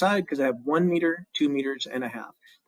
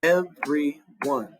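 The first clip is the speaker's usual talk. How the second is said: counting syllables; much slower than usual. Shown as a demonstration. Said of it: "So every is two syllables there".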